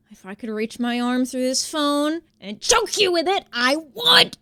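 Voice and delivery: loud, slow seething threat rising at the end; furious. Retold or imitated. Telling the rep what she wanted to do, loud seething threat. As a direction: spit it out through pure rage.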